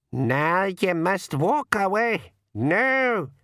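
high, slow Scots-like pixie voice; old pixie. A narrator's reading. Old pixie orders the freed king, high slow dialect.

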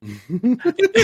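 high giggling laugh; burst of laughter. Real, not acted. Laughing at a friend's slip about Cam, high giggle. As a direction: crack up, real and unguarded.

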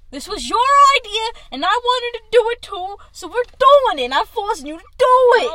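loud, high, pumped-up pep talk; excited hype. Real, not acted. Hyping up a hesitant friend.

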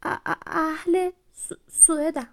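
frightened stutter. Acted. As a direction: stumble on every syllable, scared stiff. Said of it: "با لکنت گفت".

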